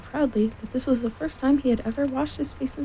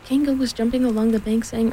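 ordinary delivery, nothing marked

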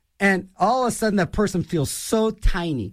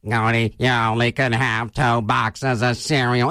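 much lower, robotic buzzing voice box; electrolarynx voice; 8 semitones lower than usual. Retold or imitated. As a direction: speak through a throat vibrator. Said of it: "He puts up one of those vibrating things".

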